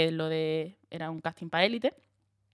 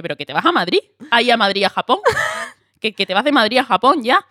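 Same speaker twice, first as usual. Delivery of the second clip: loud, eager announcement; excited news; 4 semitones higher, 8 dB louder than usual. Retold or imitated. Casting caller telling the actress she flies to Japan, loud and eager. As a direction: deliver big news with energy.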